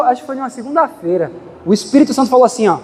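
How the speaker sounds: normal talking voice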